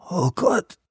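high, weak groan. A narrator's reading. Rubin watches the glass cracking, groaning. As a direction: groan in dismay. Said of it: "stöhnte Rubin".

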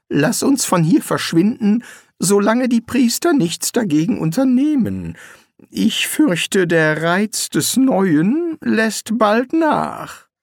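weary wizard, held back; Rincewind. A narrator's reading. Rincewind urges leaving. (höhere, langsame Zaubererstimme)

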